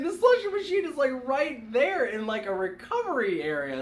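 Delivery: high-pitched, lightly jealous retelling; play-acted jealous amazement; mock jealousy. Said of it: "super jealous about that".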